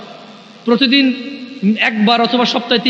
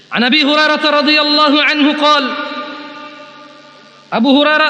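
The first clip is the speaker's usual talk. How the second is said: hadith recitation; 6 semitones higher than usual. Acted.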